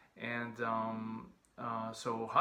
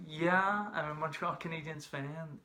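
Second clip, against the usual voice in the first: sheepish admission; 4 semitones higher, slower than usual. Retold or imitated. Sheepish admission to a rival player.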